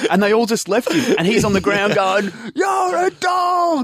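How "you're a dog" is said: long, high strained shout; high stretched-out bogan holler; mock defeated yell. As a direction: wail the insult after them, drawn out. Retold beaten man yelling from ground.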